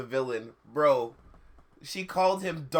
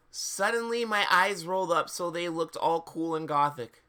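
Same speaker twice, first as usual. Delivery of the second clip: usual speaking voice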